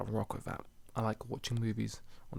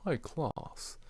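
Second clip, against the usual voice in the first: higher-pitched posh British; mock high-class British voice; high-class British accent; 6 semitones higher, 4 dB quieter than usual. Shown as a demonstration. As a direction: put on upper-class British. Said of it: "A bit high class British".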